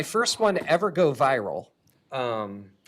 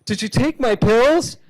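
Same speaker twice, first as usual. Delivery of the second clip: high, loud, frazzled voice hunting for pills; a foggy-brained patient panicking and yelling at the kid; confused chemo patient; 9 semitones higher, 8 dB louder than usual. Acted. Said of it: "cancer according to reality".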